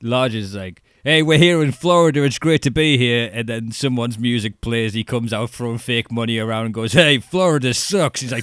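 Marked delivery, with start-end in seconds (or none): 1.0-3.3 louder, higher hammed-up wrestler greeting the crowd; cheesy promo voice. Mocking a generic wrestling promo. 6.9-8.2 louder, higher hammed-up bad-guy jab; mock heel taunt. Mock heel insulting crowd.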